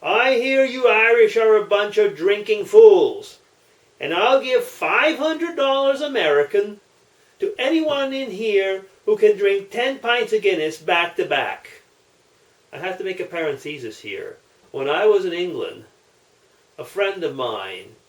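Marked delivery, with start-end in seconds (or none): 0.0-11.8 louder brash challenge to the pub; mock Texan shouting to the pub. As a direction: call out over the crowd of drinkers. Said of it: "and he shouts".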